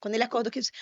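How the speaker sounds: ordinary talk, nothing marked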